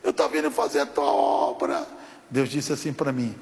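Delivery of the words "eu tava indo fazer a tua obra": imitated strained, wailing complaint; struggling protest. Injured pastor pleading his case to God, in strained protest. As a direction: argue back through pain, stretching the last word. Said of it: "E aí eu comecei a lutar".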